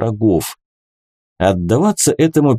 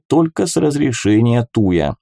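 usual speaking voice

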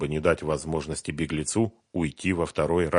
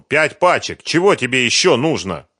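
loud, raised, impatient demand; exasperated; 7 semitones higher, 8 dB louder than usual. A narrator's reading. Фёдор showing five packs to Сухарев, exasperated. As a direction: press him, out of patience.

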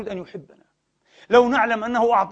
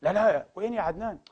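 ordinary delivery

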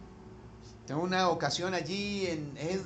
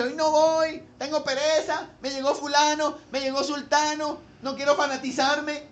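higher, louder mocking of a lazy churchgoer's excuses; 6 semitones higher, 4 dB louder than usual. Preacher mimics a lazy churchgoer skipping the service, mock whiny excuses. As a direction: ham up the lame reasons for skipping church.

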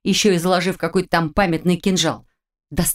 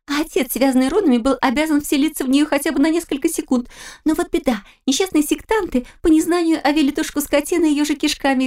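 put-on much higher, giggly teenage girl; girlish voice; 9 semitones higher than usual. A narrator's reading. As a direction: tease it like a mischievous daughter. Daughter continues teasing.

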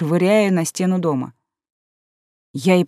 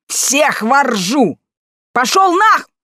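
high shrill defiant shout; defiant yell; 7 semitones higher, 5 dB louder, much slower than usual. A narrator's reading. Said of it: "интеллигентно сказала я".

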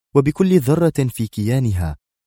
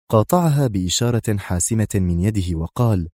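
usual speaking voice

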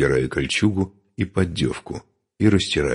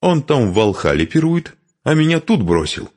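high, louder complaint; quarrelsome; 11 semitones higher, 4 dB louder than usual. A narrator's reading. Widow complaining her husband left her behind, quarrelsome. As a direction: air the grievance crossly. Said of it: "сварливо отозвалась женщина".

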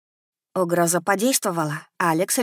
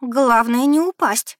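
blurted, higher and louder; 6 semitones higher, 5 dB louder, slower than usual. A narrator's reading. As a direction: let it slip out suddenly. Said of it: "невольно вырвалось у меня".

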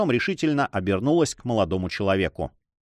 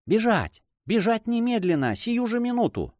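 urgent; 9 semitones higher than usual. Acted. Urging her to flee.

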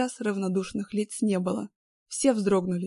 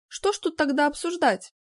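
колкость, выше тоном; с едкой насмешкой; язвительно; 7 semitones higher, 4 dB louder than usual. A narrator's reading. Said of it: "Не смогла не съязвить". (язвительно)

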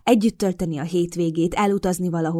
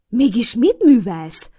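louder, slow, alarmed; slow alarmed demand; 5 semitones higher, 7 dB louder, slower than usual. A narrator's reading. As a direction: press the question in alarm. Alarmed whisper-shout at Mason.

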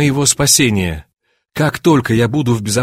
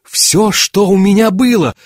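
high, raised-voice outcry; crying out; 8 semitones higher, 4 dB louder than usual. A narrator's reading. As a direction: exclaim in shocked disbelief. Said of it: "вскричал я". (громко, потрясённо восклицая)

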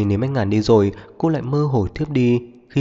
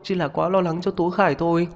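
put-on high, flustered girl's voice; frightened explaining; 9 semitones higher than usual. A narrator's reading. Girlfriend denying ill intent to his angry mother, frightened. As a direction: plead innocence in a much higher pitch. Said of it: "Diệp Lan sợ hãi, không ngừng giải thích".

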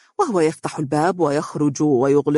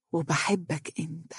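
much softer, intense murmur; passionate confession; 10 dB quieter than usual. A narrator's reading. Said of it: "وقالت بعينين ملتهبتين". (اعتراف هامس ملتهب)

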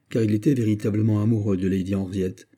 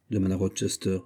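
ordinary delivery, nothing marked

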